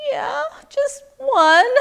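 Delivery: high, loud, drawn-out reluctant answer; wary admission. Acted. Man telling wise woman about his pig, reluctant. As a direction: admit it slowly and squeakily, dreading the result.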